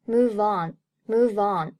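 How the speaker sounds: "move on" joined, v gliding into "on"; linked pronunciation. Shown as a demonstration. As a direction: link "move on" as one word. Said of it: "最后move和on连读".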